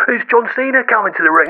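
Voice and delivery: ordinary talk, nothing marked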